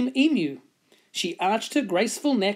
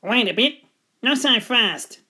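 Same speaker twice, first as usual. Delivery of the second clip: mildly indignant animal voice; bristling echidna; 2 semitones higher than usual. A narrator's reading. Echidna stopping the cook, bristling a little. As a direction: object with a touch of bristle. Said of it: "he bristled".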